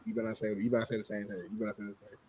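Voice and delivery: low muttered warning; hushed warning to a friend. Real, not acted. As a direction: warn him quietly, almost under the breath. Warning him under his breath.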